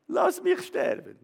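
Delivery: high slow wail to die; high drawn-out wail. Acted. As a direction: stretch it high and slow, almost a whimper.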